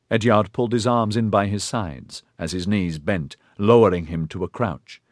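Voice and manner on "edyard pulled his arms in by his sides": usual speaking voice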